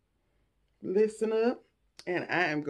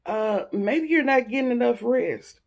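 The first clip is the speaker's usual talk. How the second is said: mocking quote; 8 semitones higher, 6 dB louder than usual. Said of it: "quoted unwanted advice".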